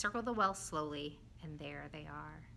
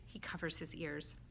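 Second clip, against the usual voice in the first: ordinary delivery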